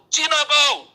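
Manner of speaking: high, loud retold jeer; mock yell taunt. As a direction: imitate a mob hurling an insult. Taunt dari pengeroyok kampung ditirukan. (tiruan teriakan ejekan bernada tinggi)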